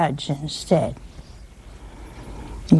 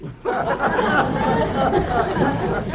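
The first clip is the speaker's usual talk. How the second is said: amused laugh; 6 semitones higher than usual. Real, not acted. Laughs at widow's anger.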